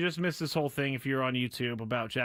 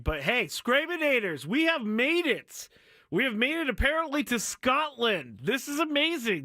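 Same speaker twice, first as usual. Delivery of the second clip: excited; 10 semitones higher, 4 dB louder than usual. Real, not acted. Host excited about Scotland mention.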